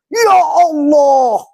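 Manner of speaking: high, slow, loud wailing Ya Allah; drawn-out cry. Retold or imitated. Mocking a complainer crying out to God. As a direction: stretch the cry out like a plea. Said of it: "Teriak dia".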